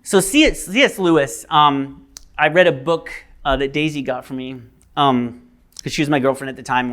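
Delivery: ordinary talk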